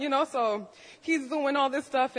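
ordinary talk

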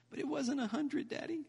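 put-on very high, small child voice; imitated kid, high and soft; upset little daughter. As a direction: whimper it like a let-down little girl. Acts out his upset daughter.

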